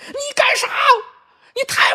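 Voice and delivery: very high mock-furious child squeal; sputtering outburst. Eldest child snaps at sibling over dinner, sputtering mock fury. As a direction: imitate a child blowing up and garbling the words. 模仿老大对老二发火.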